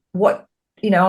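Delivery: normal talking voice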